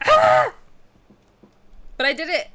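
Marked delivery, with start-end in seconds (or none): 0.1-0.5 high loud fangirl scream; excited scream. Retold or imitated. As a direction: shriek with delight. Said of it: "I screamed out loud".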